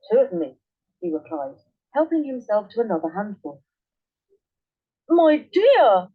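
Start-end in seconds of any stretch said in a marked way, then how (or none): 5.1-6.1 much higher, louder, mumbling through food; husband calls to wife while chewing; mouth full. A narrator's reading. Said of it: "with his mouth so full that he could hardly speak".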